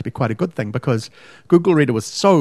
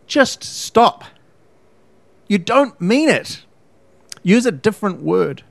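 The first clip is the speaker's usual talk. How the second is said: higher, pleading and fed up; exasperated plea; 7 semitones higher, slower than usual. Real, not acted. To people misusing awesome, exasperated and pleading. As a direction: beg them to just stop. Ranting against the word awesome.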